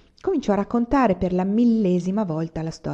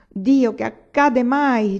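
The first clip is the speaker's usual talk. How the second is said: drawn-out, louder groan of distress; anguished moan; 5 semitones higher, 7 dB louder, much slower than usual. A narrator's reading. Anania alone, head clutched in his hands, anguished moan. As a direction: moan it slowly, in mounting anguish. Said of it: "gemé Anania stringendosi il capo".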